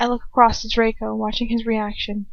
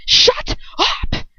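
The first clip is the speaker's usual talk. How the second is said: sharp high scream, a lightly voiced villainess; 5 dB louder than usual. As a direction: shriek it much higher at her. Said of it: "Bellatrix screams".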